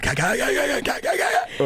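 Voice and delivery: high strangled comic gagging; mock suffocating noises. Comedian imagining suffocating funny, mock choking. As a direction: choke out high, staccato gags for laughs. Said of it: "would have suffocated funny".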